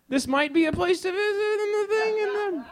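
put-on comic New York accent pitch to singles; mock New York accent. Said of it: "that's a New York accent I just coined".

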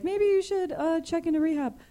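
high, prissy mock of the host; high imitation of a friend; imitating Brandon. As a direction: parrot the host's voice. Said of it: "now I'm doing your voice".

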